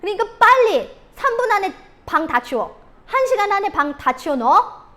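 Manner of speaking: put-on loud, high demanding order to a child; pushy parent yelling at a child; forceful command. Shown as a demonstration. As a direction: push the kid hard, bark the deadline. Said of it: "강요는 이런 식으로 하는 거죠".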